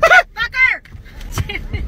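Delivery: loud startled shriek; frightened scream. Real, not acted. Scared again, yelling.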